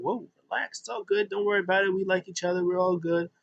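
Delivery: high, lightly acted reassurance; mock peacemaking; placating. Two squabblers acted out making peace.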